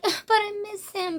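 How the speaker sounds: lightly hammed-up pining; whiny longing. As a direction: pine for him like a moping girl. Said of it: "she's probably gonna be like".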